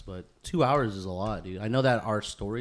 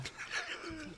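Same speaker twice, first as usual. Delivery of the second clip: high laugh; 14 dB quieter than usual. Real, not acted. Reacting to drink.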